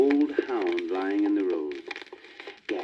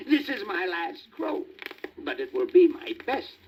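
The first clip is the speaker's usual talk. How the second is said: proud rooster; 9 semitones higher than usual. A narrator's reading. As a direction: savour each word like a farewell. Rooster's last crow.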